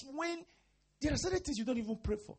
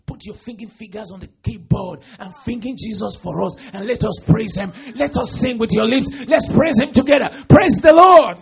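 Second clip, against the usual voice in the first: louder and louder, rising praise; rousing call to praise; 3 semitones higher, 22 dB louder than usual. Real, not acted. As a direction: build to a shouted praise.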